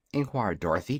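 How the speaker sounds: usual speaking voice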